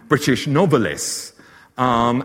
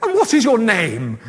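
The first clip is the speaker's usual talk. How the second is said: high, loud presenter's question; mock TV presenter; 10 semitones higher, 6 dB louder than usual. Presenter asking the swotty-looking lady, mock interviewer voice. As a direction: imitate an oblivious interviewer, pitched right up.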